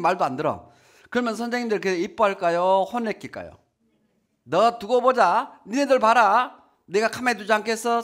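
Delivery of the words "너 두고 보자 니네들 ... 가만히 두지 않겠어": teacher's threat. Retold or imitated. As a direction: scold the naughty kids like an angry teacher. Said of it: "선생님 얘기를 하잖아요". (화난 선생님 흉내로 크게 으르기)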